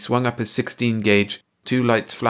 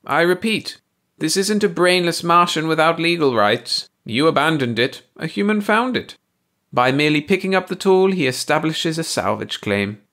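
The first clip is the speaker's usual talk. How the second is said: high alien voice; 8 semitones higher, 4 dB louder than usual. A narrator's reading. Baldy lectures Ursi.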